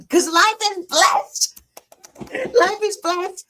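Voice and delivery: choked up, voice rising; on the verge of tears; teary. Real, not acted. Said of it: "I'm gonna get all teary up again".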